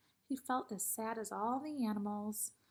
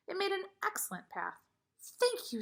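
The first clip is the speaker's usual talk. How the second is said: bright; 9 semitones higher than usual. A narrator's reading. Proud then grateful.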